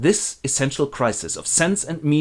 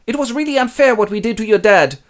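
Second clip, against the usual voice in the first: high, louder fretful complaint; guilty husband; 11 semitones higher, 6 dB louder than usual. Acted. Albany frets to his wife, high and whiny. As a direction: whine about a bad conscience.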